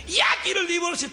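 hammed-up furious wife; demanding divorce. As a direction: snap out the demand.

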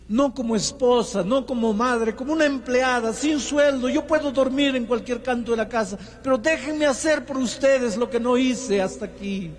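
put-on wife pleading before her husband and children. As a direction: beg humbly to be allowed to serve. Wife voiced begging at husband's feet.

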